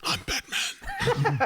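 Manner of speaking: drawn-out, strained superhero voice; hammed-up brooding hero growl, very slow; Batman. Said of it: "Give me your best Batman voice".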